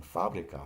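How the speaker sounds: fábrica stressed on the opening FÁ. Shown as a demonstration. Teacher showing learners the accent, FÁ stressed in fábrica. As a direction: lead with the first syllable of fábrica. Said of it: "the accent is on the first syllable".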